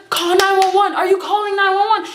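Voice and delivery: high, urgent mock panic; frantic manager impression, high-pitched; freaking out. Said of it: "like, freaking out".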